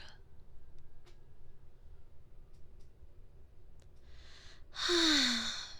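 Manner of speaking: drawn-out disappointed sigh; long weary sigh. Acted. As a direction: sigh theatrically at their silence. Disappointed no one confesses.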